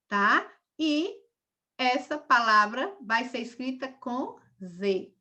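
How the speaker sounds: usual speaking voice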